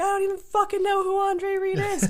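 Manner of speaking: high, loud indignant yell; retold shouting match. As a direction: re-enact screaming back in exasperation. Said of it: "we got into a shouting match".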